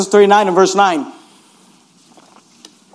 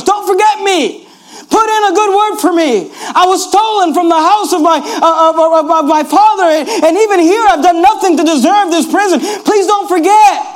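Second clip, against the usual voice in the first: pleading plea; 7 semitones higher than usual. Retold or imitated. Joseph's last plea to butler.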